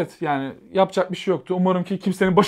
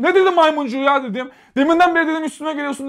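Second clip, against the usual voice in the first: indignant yell; 10 semitones higher, 5 dB louder than usual. Retold or imitated. Narrator yells back at woman.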